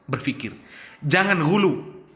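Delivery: normal talking voice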